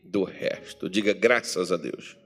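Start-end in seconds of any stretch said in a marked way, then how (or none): 0.0-0.9 slow, ringing Bible reading over music; measured pulpit reading of scripture; solemn scripture reading. Acted. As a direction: read the verses slowly with gravity. Preacher reading scripture aloud.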